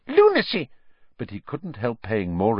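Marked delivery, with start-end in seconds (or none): 0.1-0.7 high, squeaky aside; Pettigrew distracted mutter. A narrator's reading. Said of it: "Pettigrew was muttering distractedly".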